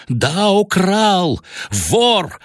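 high, dragged-out furious shout; keeps shouting. A narrator's reading. Father keeps shouting at his son, shaking him. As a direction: hammer the word украл. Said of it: "продолжал кричать я".

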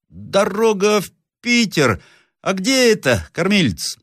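slow, high bewildered question; high-voiced slow-witted puzzlement; baffled workman. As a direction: ask dimly, drawing out the words.

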